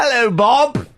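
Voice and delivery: high mock English hello; hammed-up English greeting; hacky English accent. Host greeting intern Bob in a mock English accent. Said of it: "our hacky English accent".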